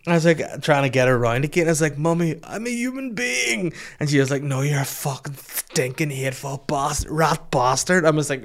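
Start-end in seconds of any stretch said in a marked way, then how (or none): 2.0-3.7 high, drawn-out mock plea; slow, anguished cry, played for laughs; Elephant Man. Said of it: "feeling like the Elephant Man there". 4.4-8.0 mum ranting in hammed-up Belfast; raised-pitch Belfast tirade, imitated for laughs; mock Belfast accent. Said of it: "you're not from Belfast".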